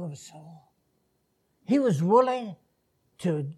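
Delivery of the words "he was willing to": rising to a high, loud shout; impassioned preaching. Real, not acted. Preacher asks God what a soul is worth, impassioned preaching.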